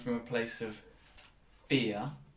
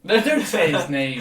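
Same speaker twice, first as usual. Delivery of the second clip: louder, cutting in to stop him; urgent protest; 3 semitones higher, 12 dB louder than usual. Real, not acted. Stopping a co-host from naming a friend, loudly. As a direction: blurt it to stop the name getting out.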